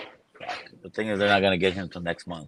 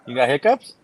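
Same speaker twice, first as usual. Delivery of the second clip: loud, higher surprised question; 7 semitones higher, 9 dB louder than usual. Real, not acted. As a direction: ask in disbelief. Surprised question about hiccups.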